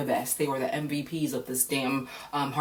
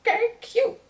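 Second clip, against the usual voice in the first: a very high, louder, put-on "Okay, cute"; squeaky mock okay; 4 dB louder than usual. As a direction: squeal it in a cutesy voice. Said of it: "So I was like".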